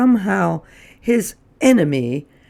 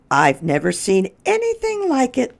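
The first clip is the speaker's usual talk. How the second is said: much higher, admiring lady's voice; 10 semitones higher than usual. Acted. A lady on the street praising Lyle's manners. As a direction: coo it in admiration. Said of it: "answered another".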